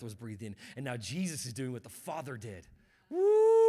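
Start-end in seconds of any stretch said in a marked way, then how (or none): 3.1-3.6 high, loud shout of joy; excited woo. Real, not acted. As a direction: whoop it out to the crowd.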